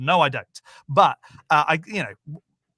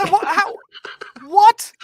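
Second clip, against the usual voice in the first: much higher and louder, in astonishment; real disbelief; 13 semitones higher, 10 dB louder than usual. Real, not acted. Reacting to the Scottish-accented alien, loud and high in disbelief. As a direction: burst out in shocked disbelief.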